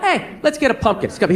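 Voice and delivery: usual speaking voice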